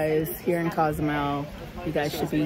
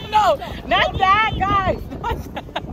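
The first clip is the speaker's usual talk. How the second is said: much higher, giggling no; giggly protest; 12 semitones higher, 3 dB louder, slower than usual. Real, not acted. Vlogger laughing at her friends' teasing, much higher giggly protest.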